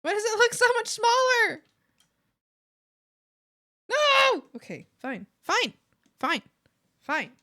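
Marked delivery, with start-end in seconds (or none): 0.0-4.4 loud, high, fast wail of protest; frustrated outburst; exasperated. Real, not acted. Frustrated at shrunken copy. 5.4-7.3 short, loud, exasperated repeats; grudging acceptance. Real, not acted. Giving in grudgingly after protest.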